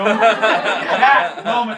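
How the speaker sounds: ordinary talk, nothing marked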